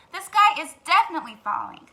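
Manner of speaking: loud, high hen insisting; insistent hen. Acted. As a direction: lean on definitely. Henny Penny insists.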